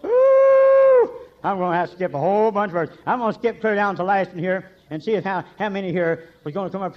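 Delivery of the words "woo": sudden, very high and loud whoop; excited whoop. Real, not acted. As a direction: let out a joyful holler.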